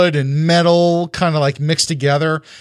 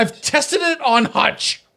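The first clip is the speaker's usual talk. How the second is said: high, drawn-out proud claim; much higher character voice, slower for effect; excited goblin; 10 semitones higher, slower than usual. Acted. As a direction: boast like a mad inventor. Excited goblin boasts of his test.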